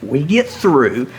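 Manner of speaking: ordinary talk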